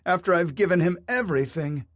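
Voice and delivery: wife crying out over what she gave him. A narrator's reading. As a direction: pour out the betrayal. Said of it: "she cried".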